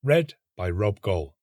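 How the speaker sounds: usual speaking voice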